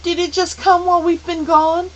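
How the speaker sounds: put-on high, loud, anguished girl; agonized question. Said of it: "Meg asked in agony".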